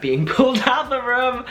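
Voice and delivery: high squeal through laughter; laughing. Real, not acted. Fan retelling being hauled away from her idol, laughing. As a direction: break up laughing as you say it, pitch shooting up.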